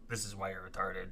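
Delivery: mock gentle. Streamer showing his helpful side, a soft mock-gentle put-down. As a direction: say the insult gently, as if being helpful. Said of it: "but I would be like".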